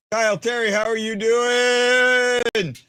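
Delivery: high, loud, long drawn-out hello; excited greeting. Real, not acted. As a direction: call out warmly and stretch the doing. Greeting a viewer on stream.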